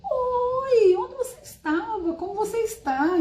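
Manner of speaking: very high, long held "Oi", then high eager questions; emphatic greeting full of missing. Shown as a demonstration. Greeting someone unseen for ages with much longing, emphatic high gushing. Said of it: "posso dar mais ênfase ainda pra dizer".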